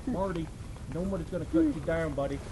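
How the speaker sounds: gentle and quiet. Shown as a demonstration. Showing folks how to comfort Marty, gentle and quiet. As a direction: soothe him like a nervous friend, softly. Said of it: "gentle and quiet with him".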